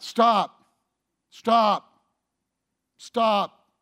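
the parent who keeps repeating himself; nagging repeated stop. Retold or imitated.

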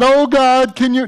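high, loud pleading "Oh God, can you?"; mock whiny prayer. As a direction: beg God like a needy child, mocking it. Mocking a begging prayer.

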